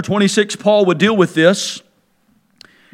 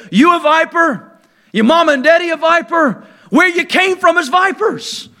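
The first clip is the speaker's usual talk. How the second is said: higher, punchy sneering taunt; mocking preacher taunt; 7 semitones higher than usual. Preacher voicing John to the Pharisees, mocking taunt. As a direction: jab each question at the crowd.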